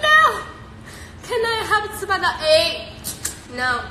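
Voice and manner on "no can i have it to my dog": high, fast mock sobbing plea; mock crying plea. Owner pleading with the vet over her sick dog, mock crying. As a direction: replay your teary begging at the vet. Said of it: "I was crying, man. I was crying".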